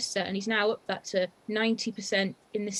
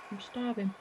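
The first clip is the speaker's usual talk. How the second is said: normal talking voice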